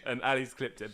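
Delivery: ordinary delivery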